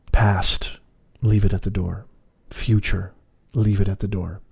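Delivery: measured, unhurried meditation voice; guided meditation. Acted. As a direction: lead them gently inward, phrase by phrase.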